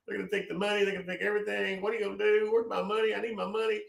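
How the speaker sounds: high-pitched mock panic; freaking out. Frightened people fretting over their money, mock panic. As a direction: whip up a hammed-up frantic fuss. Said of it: "they were freaking out".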